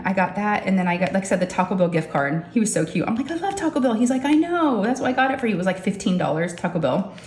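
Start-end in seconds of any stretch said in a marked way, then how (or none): 3.3-3.9 high-pitched, gushing delight; thrilled reaction, pitched well up. Retold or imitated. Acted delight at the gift card. 4.2-5.5 lightly voiced son, pitched higher; mock-cute, high-pitched reply; son's sweet reply.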